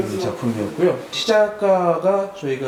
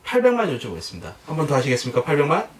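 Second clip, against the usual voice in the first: lively raised auction calling; auctioneer call; 5 semitones higher, faster than usual. Shown as a demonstration. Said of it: "시범을 한번 보여드려 볼게요".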